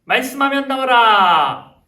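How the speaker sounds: high, drawn-out rallying shout; loud call-out. Shown as a demonstration. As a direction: call it out big for the children to copy. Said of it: "큰 목소리로 외쳐주세요".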